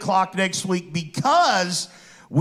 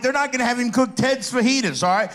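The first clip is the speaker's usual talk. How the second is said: high, insistent reasoning with a mock edge; pitched-up retold objection; exasperated protest; 6 semitones higher than usual. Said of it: "I'm like".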